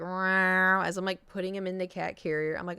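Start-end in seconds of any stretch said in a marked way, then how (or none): 0.0-0.8 louder imitated cat meow. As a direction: mimic the cat's cry. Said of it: "he's like".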